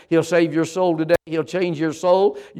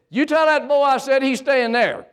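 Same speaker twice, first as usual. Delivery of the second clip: high-pitched mock fatherly holler; stern dad; 9 semitones higher than usual. Dad across the room to mom on the phone, high insistent call.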